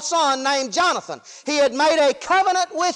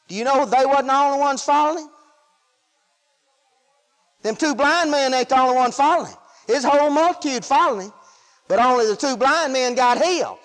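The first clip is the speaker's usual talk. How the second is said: normal talking voice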